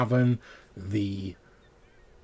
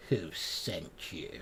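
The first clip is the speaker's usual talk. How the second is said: low, slow, softer voice from the dark; 3 semitones lower, 6 dB quieter, slower than usual. Acted. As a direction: speak it low and slow, hidden. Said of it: "low voice going".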